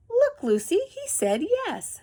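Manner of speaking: high, playful, slowed on he said yes; delighted tramp. Acted. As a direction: show the dog with glee. Bull delighted with his trick.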